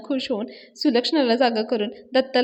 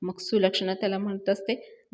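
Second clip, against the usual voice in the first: ordinary talk, nothing marked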